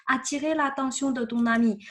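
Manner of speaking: ordinary talk, nothing marked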